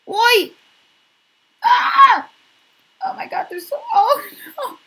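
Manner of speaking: high loud shrieks mixed with laughter; a loud, laughing freak-out; panicked screaming. Real, not acted.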